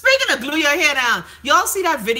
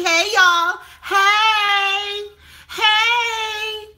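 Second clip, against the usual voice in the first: much higher, stretched-out hey; sing-song greeting; 10 semitones higher, 3 dB louder, much slower than usual. Acted. Host reading a viewer's greeting in a high sing-song. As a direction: sing the heys up high like a bright hello.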